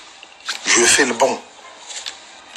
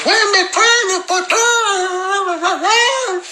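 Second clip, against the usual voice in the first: very high, slow, stammering fake tantrum; high squeaky parody of her angry outburst; mock raging girl; slower than usual. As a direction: splutter like a girl losing it, half crying. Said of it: "elle prend la rage".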